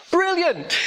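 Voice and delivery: high, bright burst of delight; delighted exclaim. Retold or imitated. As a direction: cheer it as if it's great news. Cheerful reply to bad news.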